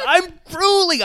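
high, loud hammed-up cry; mock garbled outburst. Co-host repeating his garbled words, loud mock wail. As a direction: imitate his blunder, crying out GRUELING. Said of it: "a couple of garbled".

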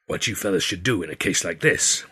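measured Wild West character voice; Wild West Billy. A narrator's reading. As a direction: say it like an old Western hand.

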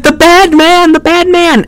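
very high mock child voice; scared little boy. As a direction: whine it like a frightened kid, hammed up. Voices frightened little Timmy.

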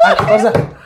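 high airy laugh around the word; real laughter. Real, not acted. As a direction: crack up repeating it. Laughing at own story.

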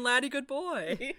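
lightly voiced dopey dog; dim dog voice. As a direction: sound happily brainless. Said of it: "He's just sitting here saying".

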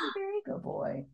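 high, very soft doting coo; talking to a dog. Real, not acted. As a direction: whisper praise to the puppy. Praising the dog.

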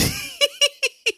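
a high gleeful giggle after the words. Real, not acted. Gloating over Cody losing, a high gleeful giggle. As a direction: giggle with glee at the loss.

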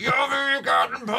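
high-pitched imitated tantrum yell; mock toddler shriek; child's screaming refusal. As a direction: wail it like a furious small child. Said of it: "og råber og skriger".

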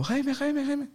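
high, urgent imitated summons; high-pitched repeated name call; acted calling out. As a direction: mimic someone calling him over. Someone calls him over to Gonzalo.

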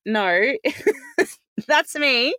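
amused slow reveal; laughing correction. Retold or imitated. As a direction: giggle while setting them straight.

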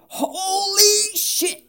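high, long drawn-out cry of alarm; mock shock. Tram driver as it leaves the rails, hammed-up shock. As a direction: stretch it out in comic horror.

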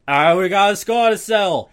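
slurred bad boxer impression; mumbled mock tough-guy voice, half laughing; Rocky Balboa. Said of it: "I don't know what Rocky Balboa sounds like".

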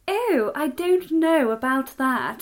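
mock plummy posh English, slow and drawn out; plummy accent. Mocking a royal-family plummy accent for the listeners. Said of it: "sounds a bit like you've got a plum in your mouth".

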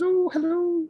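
put-on high-pitched sweet old lady; put-on falsetto of a kindly old dame; Missus Doubtfire. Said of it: "sweet old lady".

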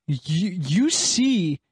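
slow dramatic reveal; a hint of an ominous game master reveal. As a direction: drag out the punchline like a storyteller. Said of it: "your delivery was fantastic".

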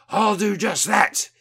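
loud, high, drawn-out tipsy declaration; blurry, tipsy bluster. A narrator's reading. Windy resolving to confront the officials, blurry and loud. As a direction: declare it boldly with a drink-blurred tongue. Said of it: "says a blurry Windy".

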